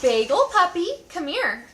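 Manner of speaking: dog call across the room. Real, not acted. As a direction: call the dog over happily. Calling the dog.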